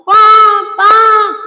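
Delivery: loud high honking "bum, bum"; imitated car horn. Mother honks at cars blocking her, loud high horn imitation. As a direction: blast it like a horn. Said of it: "I'm blowing my horn".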